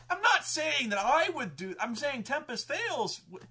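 high, loud defensive imitation; protesting. Game master defending his character to players, high protest. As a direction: protest upward, defending yourself.

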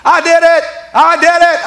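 high, loud holler; excited high-pitched yelling; imitated scream. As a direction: scream it across to another vehicle. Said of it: "somebody screamed at me".

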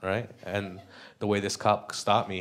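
usual speaking voice

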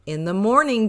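ordinary delivery

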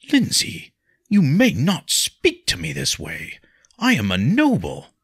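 high, loud affronted reproof; shocked rebuke. A narrator's reading. Stunned nobleman rebuking her language, affronted. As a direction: pull rank, loud and deliberate.

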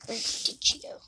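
hushed whisper. Real, not acted. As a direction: whisper it softly. Whispering while searching.

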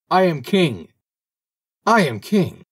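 high, loud, penetrating proclamation; clear penetrating call. A narrator's reading. Little bird calling down from heaven, clear penetrating cry. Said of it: "called down with a clear and penetrating voice".

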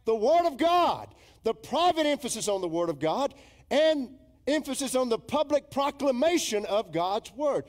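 loud, high, ringing proclamation; forceful preacher's declaration; emphatic preaching. Real, not acted. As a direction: drive each point home from the pulpit.

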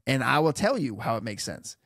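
ordinary delivery

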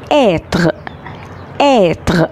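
"Être" with the mouth opened wide and square on the e. Shown as a demonstration. French teacher to class, "Être" with the mouth open wide. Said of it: "نفتح بقنا كده هوت خالص".

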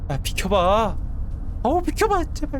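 점점 높아지는 다급한 목소리; 길을 비켜 달라고 겁에 질려 빌기; 겁먹은 애원. Real, not acted. 무서운 것이 길을 막음. (겁에 질린 다급한 애원)

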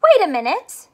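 ordinary talk

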